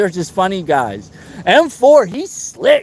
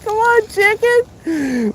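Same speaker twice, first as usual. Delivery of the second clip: mocking taunt; 12 semitones higher, much slower than usual. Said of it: "I was like".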